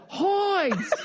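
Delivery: high laughing repeat of hides; echoing Corrielli. Retold or imitated. Friend repeating Corrielli's line, high and laughing. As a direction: squeak the word hides while cracking up. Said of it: "I love that".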